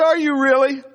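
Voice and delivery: high, loud "are you really?"; mock surprise. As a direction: act shocked at the news. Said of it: "I said".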